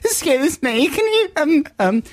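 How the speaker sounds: very high, hesitant timid plea; mock-nervous stammering objection; tremulous, frightened voice. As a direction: put on a frightened, faltering high voice. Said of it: "his very tremulous, frightened voice".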